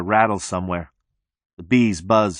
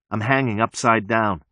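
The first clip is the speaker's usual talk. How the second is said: usual speaking voice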